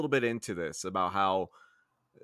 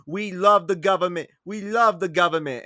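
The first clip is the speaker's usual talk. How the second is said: higher and louder, hammed-up patriotic cheer; mock Italian immigrant; 6 semitones higher, 6 dB louder than usual. Mocking Italian immigrants cheering for the government, hammed-up. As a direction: belt it like a proud new arrival. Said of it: "Italians come to America and be like".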